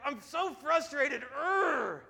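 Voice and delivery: high, drawn-out frustrated cartoon voice; Daniel Tiger. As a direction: stretch the words, end in a loud growl. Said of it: "and I hear".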